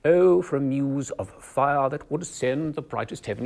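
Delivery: upper-class English accent, slow and upright in the mouth; standard English accent. Shown as a demonstration. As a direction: standard English, as if speaking through a crack in a door. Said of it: "imagine you're speaking through a crack in a door".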